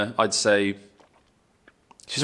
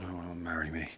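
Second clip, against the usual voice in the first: much softer, smitten aside; dreamy inner thought; 4 semitones lower, 16 dB quieter than usual. Retold or imitated. Seeing a pretty girl in a car, soft smitten aside. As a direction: breathe it like love at first sight. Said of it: "I immediately thought".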